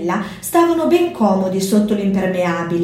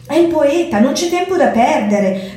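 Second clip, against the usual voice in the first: urgent mew; 6 semitones higher than usual. A narrator's reading. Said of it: "miagolò Zorba".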